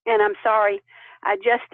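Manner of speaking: usual speaking voice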